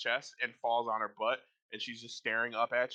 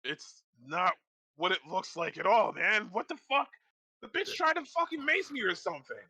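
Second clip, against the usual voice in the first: higher, rising outraged protest; indignant guard; 7 semitones higher than usual. Acted. Guard protesting to the knight that he's innocent. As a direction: complain bitterly that you're innocent.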